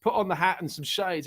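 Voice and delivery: ordinary talk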